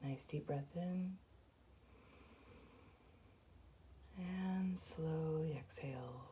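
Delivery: soft, even meditation voice with long pauses; guided meditation. Acted. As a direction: guide them gently through each breath, hushed and unhurried. Leads a guided breathing meditation.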